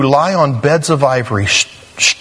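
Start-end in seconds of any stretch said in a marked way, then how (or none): none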